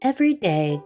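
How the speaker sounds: ordinary delivery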